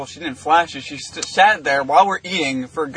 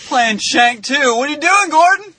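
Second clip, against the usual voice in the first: mock calling out; 9 semitones higher, 4 dB louder than usual.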